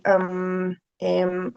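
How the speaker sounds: drawn-out, level "um" as a filler; filler hesitation. Shown as a demonstration. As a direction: demonstrate stalling for thought. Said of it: "sometimes we have muletillas".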